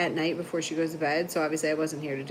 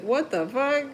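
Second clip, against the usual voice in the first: mock offended; 8 semitones higher than usual. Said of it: "so offended".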